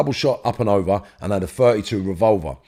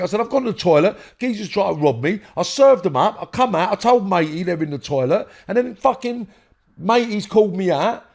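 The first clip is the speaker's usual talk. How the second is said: retold heated explanation; 11 semitones higher than usual.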